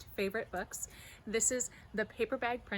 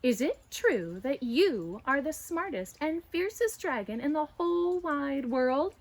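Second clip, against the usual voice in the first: flattering; 5 semitones higher, 6 dB louder than usual. Acted. Flattering the dragon.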